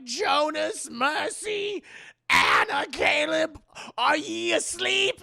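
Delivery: high, loud drawn-out calling; shrill calling mother. Retold or imitated. Mother calling her children's names at night. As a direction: call each name in a screeching voice.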